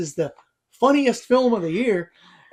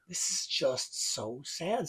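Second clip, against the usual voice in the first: slow, softer, sad mumble; deflated sadness; 8 dB quieter, much slower than usual. Retold or imitated. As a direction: let the air out, dejected. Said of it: "and you're like".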